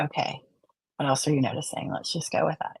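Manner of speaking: a near-whisper of calm encouragement; hushed therapist voice. Shown as a demonstration. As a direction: gently invite the client onward. Therapist voice guiding a client.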